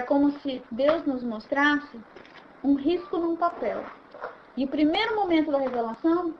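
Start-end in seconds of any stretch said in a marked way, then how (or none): none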